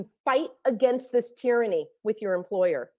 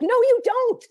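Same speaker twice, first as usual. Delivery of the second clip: high, loud, cutting no; indignant shout; 11 semitones higher, 5 dB louder than usual. Real, not acted. Ranting at followers who say they must fly, loud indignant shout. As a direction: shout it down, flat refusal.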